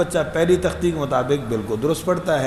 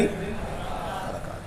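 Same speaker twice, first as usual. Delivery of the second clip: whispered answer; 3 semitones higher, 12 dB quieter, much slower than usual. Shown as a demonstration. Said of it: "کیا ہیں بھائی؟".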